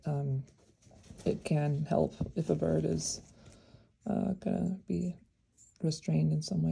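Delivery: normal talking voice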